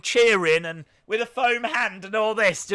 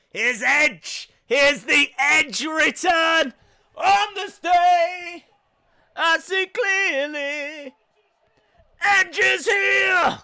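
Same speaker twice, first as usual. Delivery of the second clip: slow, high hammed-up announcer shout; mock announcer call; 9 semitones higher, 6 dB louder, much slower than usual. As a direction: belt out the entrance like a ring announcer. Announcer-style Edge entrance call.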